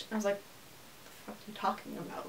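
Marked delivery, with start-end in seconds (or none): 1.3-2.3 whispered disbelief. Retold or imitated. Stunned at failing the test.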